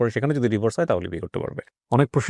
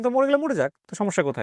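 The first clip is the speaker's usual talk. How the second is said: exasperated dismissal; 9 semitones higher than usual. Real, not acted. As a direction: voice jumps much higher in disbelief. Interviewer dismisses the suicide threat.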